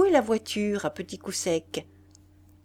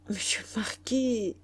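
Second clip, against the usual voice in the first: chuchoter une supplique timide; supplication chuchotée; 3 semitones higher, 3 dB quieter than usual. Acted. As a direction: murmure implorant, sans voix. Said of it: "supplia le pauvre facteur". (supplier à voix basse, chuchoté)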